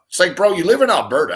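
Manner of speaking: ordinary delivery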